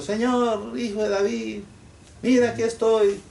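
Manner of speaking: high, pleading call of a blind beggar; blind man's cry. Acted. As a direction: cry out high to Jesus for help. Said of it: "que estaba gritando". (el ciego suplica a Jesús con un grito agudo)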